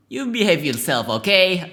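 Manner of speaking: a hint of a scolding mom; mom voice attempt. Player voicing the mom at the car window, attempted mom voice. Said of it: "that's not a mom voice".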